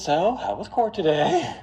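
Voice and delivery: put-on high wifely question; acted wife. Wife asking husband about court, high mock-innocent voice.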